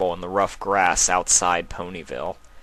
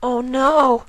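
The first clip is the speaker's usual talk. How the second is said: high gasping little filly voice; shocked filly; 5 dB louder than usual. Acted. Filly gasping at the scary story, high and shocked. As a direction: react in fright.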